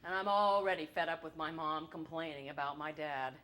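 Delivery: normal talking voice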